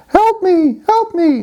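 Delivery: high falsetto cry for help; tiny squeaky frog calling out in a joke; frog princess voice. Acted. Frog calls to jogger for help, high falsetto. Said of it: "he hears his voice".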